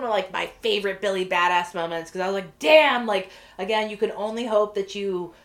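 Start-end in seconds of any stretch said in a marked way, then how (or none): 2.6-3.1 loud, higher burst of admiration; impressed exclamation. Retold or imitated. Officer marvelling at her own bold moment, loud exclamation. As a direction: punch it out, impressed.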